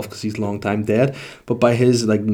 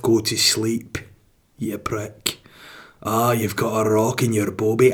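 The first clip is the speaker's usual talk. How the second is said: put-on Scottish voice, ordering bed; understated Scottish telling-off; Scottish accent; slower than usual. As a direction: play the body as a blunt Scot. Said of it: "I don't know why it's Scottish".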